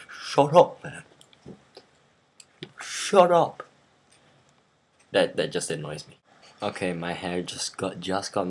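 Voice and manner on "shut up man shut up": loud, high-pitched acted shout; mock furious outburst. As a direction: explode on each shut up. Acting out classmates' inner fury.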